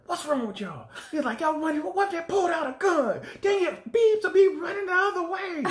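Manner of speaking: high, loud, exasperated teacher yelling; imitating angry teacher. Teacher yelling at students running toward a fight, angry. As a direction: rant at the kids like a furious teacher. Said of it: "And he was like".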